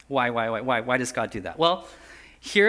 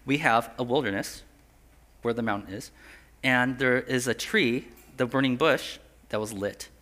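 ordinary talk

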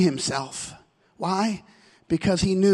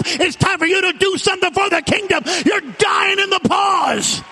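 preaching at full force; 6 semitones higher than usual. Acted. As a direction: drive every line at the congregation. Preaching climax, shouting.

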